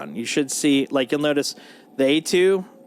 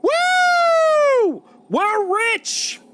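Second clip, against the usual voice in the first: high, loud triumphant shriek; shrieking jackpot winner; 5 dB louder, much slower than usual. Real, not acted. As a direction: squeal like you hit the jackpot. Huge cargo sale payout.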